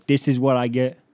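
loud, exasperated shout upward; yelling at the sky. Retold or imitated. Comedian yelling at Jesus, loud and fed up. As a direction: yell up at God, fed up. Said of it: "I yell up at the sky".

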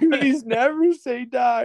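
very high weepy squeal; mock tearful. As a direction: imitate a choked-up victory cry, high and strained. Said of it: "I started crying too".

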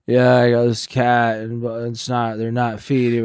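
imitating himself slurring on the phone; wasted drug user. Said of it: "fucked up out of my mind".

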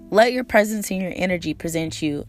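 ordinary delivery

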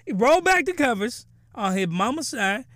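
ordinary talk

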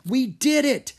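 loud, high triumphant cheer. Acted. Drake cheers after the dragon is caught, loud and high. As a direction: celebrate the win. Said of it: "Drake cheered".

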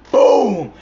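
a sudden high, loud boom; imitated gunshot boom. Gun goes off in the car: loud high boom.

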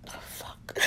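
a breathy curse under the crying; just breathe the words out, overwhelmed; tearful whisper. Real, not acted. Losing composure before saying she'll get it together, whispered.

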